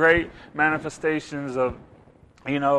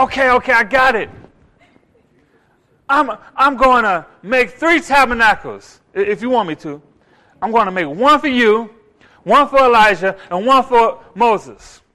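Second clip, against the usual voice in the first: higher, louder eager offer, slowing at the end; overeager, pleading to help; eager Peter; 4 semitones higher, 8 dB louder than usual. Retold or imitated. Peter eagerly offering to build tabernacles.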